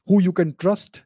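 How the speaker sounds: ordinary talk, nothing marked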